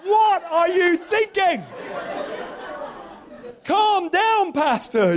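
loud, high Nigerian English exclamation; hammed-up Nigerian pastor-scolding voice; Nigerian English accent. Shown as a demonstration. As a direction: shout it in a big Nigerian accent. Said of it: "can do a really good Nigerian English accent".

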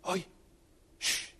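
short "oi" and a soft shh; quick hushed "oi, shh"; retold shushing. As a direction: imitate shushing chatterers under your breath. Retold shushing of whispering officers.

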